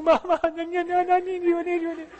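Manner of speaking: put-on high little-boy plea; needy toddler. Preacher voicing his son begging mama, high child voice. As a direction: beg like a small child. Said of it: "He's like".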